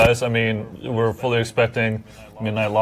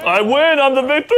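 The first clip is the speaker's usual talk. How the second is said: high, loud victory cry; triumphant shout; 12 semitones higher, 4 dB louder than usual. Real, not acted. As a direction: crow about the win. Winner celebrating.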